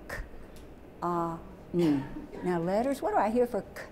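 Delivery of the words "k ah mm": "K", "Ah", "mm" as isolated speech sounds; separate sounds. Shown as a demonstration. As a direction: sound out "K. Ah, mm" one sound at a time. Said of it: "We're gonna let it just out".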